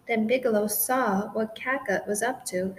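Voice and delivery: ordinary delivery, nothing marked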